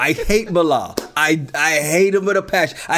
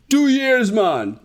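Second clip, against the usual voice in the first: exaggerated, higher-pitched Jamaican accent; mock Jamaican accent; 6 semitones higher, much slower than usual. Host mocking how people act Caribbean, mock Jamaican accent. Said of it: "American vision of a Jamaican".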